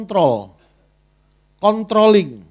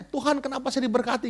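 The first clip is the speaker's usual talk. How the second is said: mock grateful question; 6 semitones higher, 4 dB quieter than usual. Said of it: "nggak pernah tanya".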